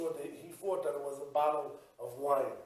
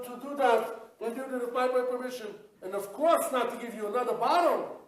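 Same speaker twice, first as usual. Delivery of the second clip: loud, higher scolding from the boss; angry boss; 8 semitones higher, 5 dB louder than usual. Acted. Drugstore boss to new clerk, loud indignant scolding. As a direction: tear into the clerk for giving it away.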